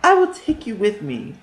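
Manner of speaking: high scheming witch; false kindness of a witch. A narrator's reading. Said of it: "the witch told them".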